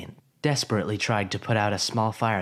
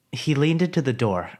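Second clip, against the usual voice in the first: ordinary delivery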